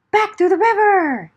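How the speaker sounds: fast, high, breathless flight; frantic retreat; panicked rush. A narrator's reading. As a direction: hurry as if chased. Fleeing the bear.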